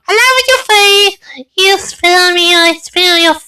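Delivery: very high put-on squeaky voice; shrill mock falsetto taunt. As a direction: play a silly high-pitched cartoon villain.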